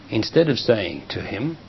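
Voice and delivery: usual speaking voice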